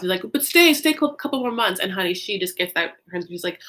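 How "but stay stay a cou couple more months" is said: higher-pitched retold plea; mock pleading, starting high and louder; hasty backpedal. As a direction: imitate someone hurriedly taking it back. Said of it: "realizes how shitty that sounds".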